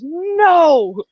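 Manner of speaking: high, loud, drawn-out mock no; panicked refusal. One friend stopping the other, mock panicked refusal. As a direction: act out chickening out with a big no.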